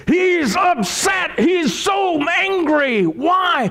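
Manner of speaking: high, slow preaching shout. Acted. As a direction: boom it out like a fired-up preacher. Preacher shouting about Naaman's rage.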